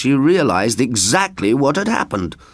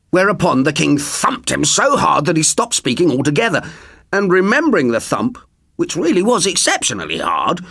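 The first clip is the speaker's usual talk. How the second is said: ordinary delivery, nothing marked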